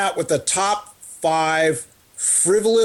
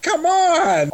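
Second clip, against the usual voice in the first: exasperated; 11 semitones higher than usual. Real, not acted. Exasperated at silly warning.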